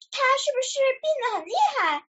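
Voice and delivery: high-pitched, quick anxious question; very worried. A narrator's reading. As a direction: ask with mild storybook concern. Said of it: "小鸭也非常担心".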